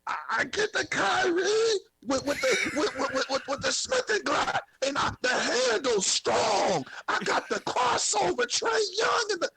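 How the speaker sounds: exaggerated high voice, tripping over the words; O-Red. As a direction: parody a rapper in a strained high voice. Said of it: "you want me to sound like, Oh, Red".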